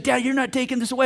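put-on a touch of teen defiance; fighting back. Daughter arguing while walking downstairs, mildly heated protest. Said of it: "she's fighting me".

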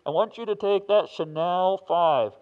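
big, slow, loud divine command; God speaking down, loud and slow; booming voice of God. Retold or imitated. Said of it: "there was like God saying".

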